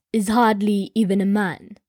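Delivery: a lightly voiced king, low and scornful; disgusted king. A narrator's reading. King to court about the monk, low mild disgust. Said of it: "he said in disgust".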